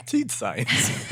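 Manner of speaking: high, laughing echo of a silly phrase; laughing repeat. Real, not acted. Repeats a funny phrase, amused.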